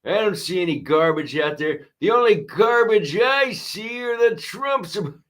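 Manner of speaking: a light mock Biden impression; imitating Joe Biden. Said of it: "Joe Biden ... I'm paraphrasing".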